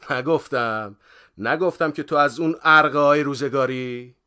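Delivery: high, very loud teasing yell; gleeful shout. A narrator's reading. Friend teases departing man from behind, shouting in amusement. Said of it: "با خنده داد زد".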